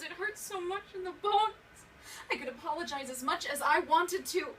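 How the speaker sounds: loud, high, frantic retelling; panicked begging at full voice; desperate pleading. Acted. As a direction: relive the beating, voice rising into cries. Re-enacts beating, begging for mercy.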